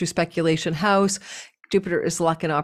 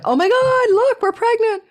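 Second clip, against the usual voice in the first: squealing much higher, louder cartoon voice; excited pregnancy test; 4 dB louder than usual. Pregnancy test announcing the news to Sagittarius, squealing. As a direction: gush like a thrilled little test stick. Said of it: "the pregnancy test going".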